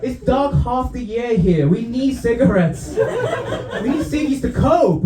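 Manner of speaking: high, drawn-out comic protest; exasperated plea. Acted. Comedian arguing Britain needs ciggies to cope, exasperated plea. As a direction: plead slowly, pitch climbing toward the end.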